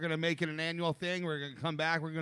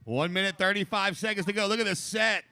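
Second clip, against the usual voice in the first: laid-back surfer announcer; 2 semitones higher, 4 dB louder than usual. Real, not acted. Announcer counts down heat time.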